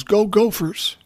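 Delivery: put-on high little-boy cheer; kid's cheer. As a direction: shout it out like an excited five-year-old. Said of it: "cheers and yells".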